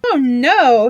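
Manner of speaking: loud false modesty; mock modest. A narrator's reading. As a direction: brush off the praise grandly. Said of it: "he said".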